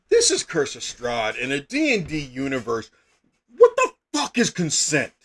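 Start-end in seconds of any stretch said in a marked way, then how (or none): 3.6-5.1 higher and louder, exasperated; mock-outraged burst at the absurdity; incredulous outburst. Real, not acted. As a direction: throw it out in disbelief. Incredulous outburst about NPC consent.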